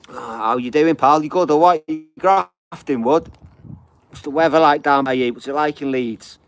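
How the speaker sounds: big high hello shouted to a pal; loud greeting. Real, not acted. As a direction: call out warmly across the room. Greeting a viewer on live.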